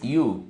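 "U" said as English "you"; natural vowel sound. Shown as a demonstration. Teacher shows learners the natural sound, "U" as English "you". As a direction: say "U" as a gliding "iu". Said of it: "su sonido natural es".

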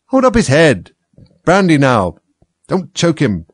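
high, loud, urgent instructions; flustered helpers calling out; confused shouting. A narrator's reading. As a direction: shout over others in an emergency. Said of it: "another confusion of voices".

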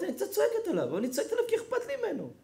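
lightly voiced anxious assistant; imitating the assistant. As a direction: mimic her excuse in a high little voice. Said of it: "היא אומרת".

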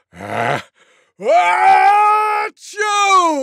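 loudest mock sneeze. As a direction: wind up and explode in a huge sneeze. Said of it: "the loudest sneeze you could ever imagine".

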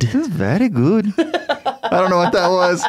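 high voice breaking into laughter; amused, laughing hard at oneself. Real, not acted. Laughs at own silly voice.

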